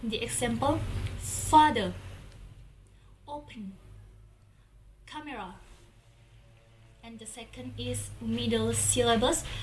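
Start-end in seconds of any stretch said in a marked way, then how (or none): 1.2-5.6 "Father," "open," "camera," each with the first syllable strongest; first-syllable stress. Shown as a demonstration. Teacher showing stress at the beginning of "Father," "open," "camera," first-syllable stress. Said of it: "stress is in the beginning".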